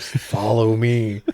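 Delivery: usual speaking voice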